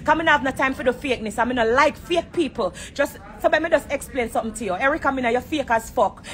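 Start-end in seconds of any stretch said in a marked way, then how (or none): none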